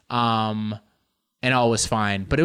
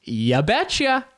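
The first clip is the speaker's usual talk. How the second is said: slow, hammed-up Minnesota ya betcha; a hint of a northern Midwest twang; mock Minnesota; 6 semitones higher, slower than usual. Shown as a demonstration. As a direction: drawl it like a Midwesterner. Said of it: "am I gonna keep trying".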